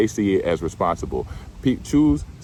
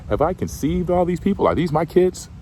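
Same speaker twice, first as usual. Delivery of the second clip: high, exasperated complaint to God; whiny Moses; 11 semitones higher than usual. Retold or imitated. Moses whining to God about the people, high and exasperated. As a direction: Moses throwing up his hands at God.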